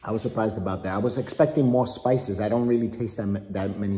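chewing between words; nibbling fruit while talking; mouth full of mango. Real, not acted. Seen 0: Dad repeatedly bites and nibbles mango slice at mouth while talking.

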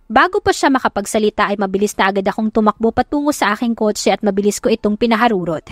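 ordinary delivery

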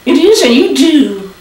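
high, cheeky puppet voice; a wisecracking little dummy; squeaky ventriloquist dummy. Acted. Dummy insult joke.

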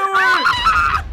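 high, loud shriek; startled scream. Real, not acted. Player who set off the TNT, startled scream. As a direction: scream in sudden alarm.

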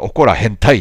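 loud mock Kansai-Kyushu dialect reading of 怒ら変態; mock dialect quote. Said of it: "関西弁となんか九州弁が混ざったような感じ".

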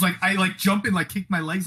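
usual speaking voice

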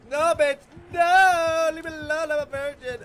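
loud, high hammed-up begging; mock pleading scream. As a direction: scream it like a horror victim. Mock pleading with killer in game.

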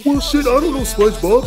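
ordinary talk, nothing marked

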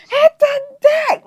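very high, loud, drawn-out yell; mock warning yell. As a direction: playfully scream it out, stretching the words. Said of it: "randomly just yell it".

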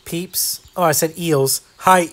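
usual speaking voice

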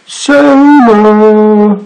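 high, long-held call. A narrator's reading. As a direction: call for someone out of sight. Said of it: "he called".

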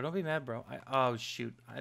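ordinary talk, nothing marked

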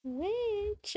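high drawn-out call; witch summoning voice. Pretend. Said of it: "my witch summoning voice".

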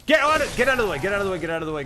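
loud, high frantic yells to get out of the way; panicked yelling. Real, not acted. Gamer panicking as a burning enemy charges, yelling.

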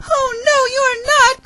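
very high, squealed protest; crying out. Acted. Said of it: "cried the cat".

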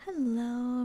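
usual speaking voice

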